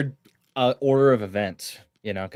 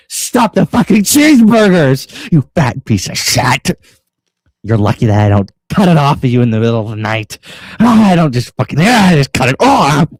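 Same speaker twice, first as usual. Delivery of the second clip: louder hammed-up yelling; mock furious rant; 8 dB louder than usual. [name] yelling at Yam live on air, hammed-up fury. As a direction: rant at her like a raging dad. Acted quarrel, [name] ranting.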